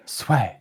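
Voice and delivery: "swag" cut short, the final g barely there; corrected swag. Shown as a demonstration. Correcting a friend who said it wrong, "swag" with the ending dropped. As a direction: say "swag" with a swallowed g. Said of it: "you said it wrong".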